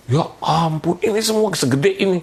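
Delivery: high-pitched retold disbelief; mock astonishment. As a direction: act amazed at the sheer size. Retold shock at the rich owner. (kaget heboh menirukan, suara tinggi)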